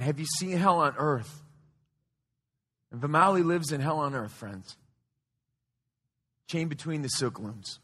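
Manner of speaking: quiet, earnest sermon voice; hushed preaching. Acted. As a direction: drop to a near hush to draw them in.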